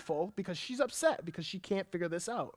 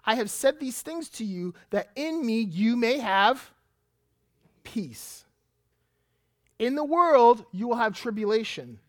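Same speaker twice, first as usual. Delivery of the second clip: louder, rising preacher's reading of scripture; preaching scripture; 2 semitones higher, 6 dB louder than usual. Acted. Preacher reading Jesus's words to the church, proclaimed. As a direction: proclaim the verses to the congregation.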